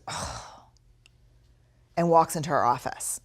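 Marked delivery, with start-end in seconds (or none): 0.1-0.6 a curt, breathless little oh, almost voiceless; mild mock of a cold brush-off; dismissive oh. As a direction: brush him off with a barely voiced oh. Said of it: "Michonne looks at me and goes".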